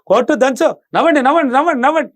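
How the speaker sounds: mother rousing the family to laugh, excited; cheering everyone on. Shown as a demonstration. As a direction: urge the family to laugh, rising each time. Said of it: "అని అందరిని కూడా encourage చేయి".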